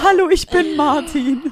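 high, loud, slow introduction; eager young guy. Retold or imitated. Mimicking Martin introducing himself through the car window, high and eager. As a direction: introduce yourself like a keen boy. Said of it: "er so".

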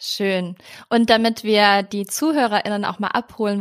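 ordinary delivery

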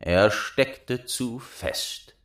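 ordinary delivery

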